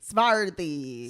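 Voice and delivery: "Swarthy" said as "svarthy". Shown as a demonstration. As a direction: start "Swarthy" with a V. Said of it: "W's have to turn into V's".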